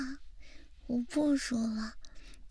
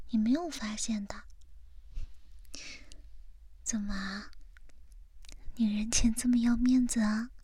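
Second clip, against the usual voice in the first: whispering. Acted. Whispered ASMR girlfriend talk.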